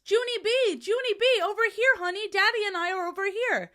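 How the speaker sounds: high, far-off mom calling out; calling mother. A narrator's reading. Mother calling Junie B from the parking lot, high and loving. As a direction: call across the parking lot to your child. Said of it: "I Heard My Mother's Voice".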